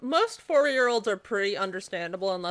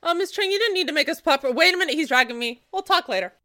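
hurried excited; 8 semitones higher, 6 dB louder, faster than usual. Acted. Being dragged by child.

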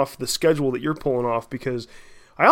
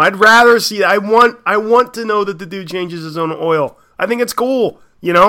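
emphatic; 4 semitones higher, 9 dB louder than usual. Real, not acted. Emphatic opinion on band image.

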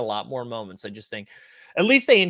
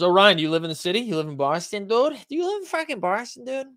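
put-on excited Boston accent, higher; Boston accent, pitched way up; 7 semitones higher than usual. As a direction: quiz the viewer like a Boston local. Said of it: "that accent was any of my neighbors".

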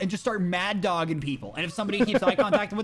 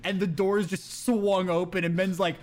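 usual speaking voice

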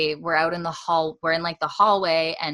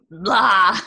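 loud blurted "blah" of disgust; mock revolting blah; 3 semitones higher, 7 dB louder than usual. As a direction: imitate a body revolting, played for laughs.

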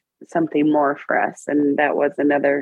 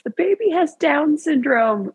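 high-pitched retold blurt; 10 semitones higher than usual. Mother telling her husband the test result, blurted out. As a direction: blurt the news, pitch jumping up. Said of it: "all I could blurt out was".

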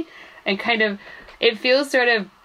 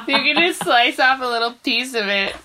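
laughing; 4 semitones higher, 3 dB louder than usual. Real, not acted. Laughing while pitching idea.

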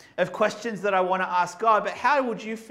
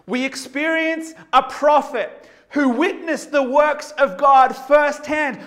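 higher and louder, hammering "God" and "firsthand"; preached loud; 8 semitones higher, 9 dB louder, slower than usual. Shown as a demonstration. Preacher to the congregation, repeating the line louder and higher. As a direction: hit "firsthand" with full pulpit force. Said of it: "same line repeated, preached up".

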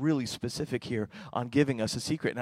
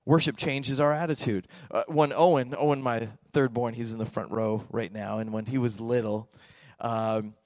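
ordinary talk, nothing marked

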